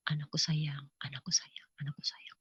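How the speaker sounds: put-on hushed, loving whisper to a child; barely audible, soothing repetition; tender whisper. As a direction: whisper it as if cradling someone. Jesus tenderly cradling the speaker.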